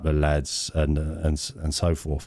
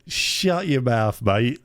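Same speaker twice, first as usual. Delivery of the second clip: higher, drawn-out dismissal in imitation; retold incredulous put-down; mock scoffing retort; 6 semitones higher, much slower than usual. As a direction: stretch it out like a scoffing lad. Lad scoffs at the boaster, retold.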